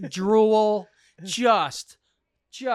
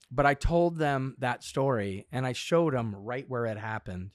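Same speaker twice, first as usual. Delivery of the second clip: usual speaking voice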